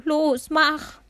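hoch und laut, drängend; flehend gerufen. A narrator's reading. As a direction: fleh ihn laut an, es zu sagen. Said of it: "schluchzte Benno". (Benno drängt den Vater laut und verzweifelt)

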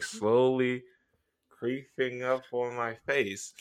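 slow creeping. Retold or imitated. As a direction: slowly creep through the words, fading down. Acting out slow creeping smile.

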